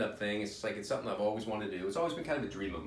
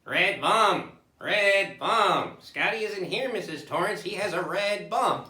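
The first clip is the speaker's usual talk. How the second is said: loud, higher croaking chant; possessed child parody; 7 semitones higher, 6 dB louder, slower than usual. Acted. Comic spoofing a horror film to audience, possessed child voice.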